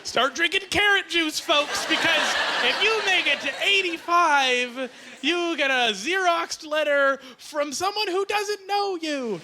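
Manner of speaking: high, drawn-out sarcastic hype; mock excitement. Comic hyping a form letter to the crowd, mock excitement.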